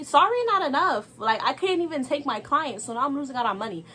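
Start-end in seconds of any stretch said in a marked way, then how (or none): 0.0-1.2 loud, high indignant snap; indignant retort. Real, not acted. Telling viewers the apology didn't cut it, indignant. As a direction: shut the apology down hard.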